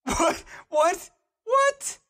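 high, rising disbelief. Real, not acted. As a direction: ask in stunned surprise. Baffled by ending.

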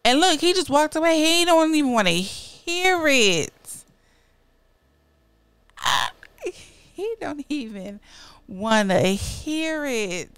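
furious that he just walked off; indignant outburst. Pretend. Partner walks away mid-scolding.

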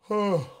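long yawning oh. Shown as a demonstration. Coach yawning along with the viewers, open-throated yawn. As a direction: yawn wide on a sighed oh. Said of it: "Go ahead and yawn with me".

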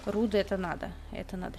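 ordinary talk

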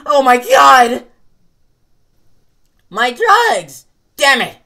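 shouting. Acted. Lost drugs outburst.

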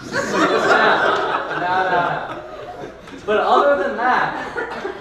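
laughing through the words. Real, not acted. After a joke about wives, laughing it off. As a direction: chuckle at his own joke.

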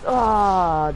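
loud falling "aah"; frustrated cry. Real, not acted. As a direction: let out a loud cry of defeat. Loses in the game.

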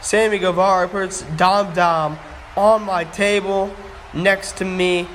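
usual speaking voice

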